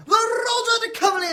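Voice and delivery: high, loud, fast announcement; rapid shouted announcement; announcing shout. Acted. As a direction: call the dance to the room. Fezziwig announcing the dance.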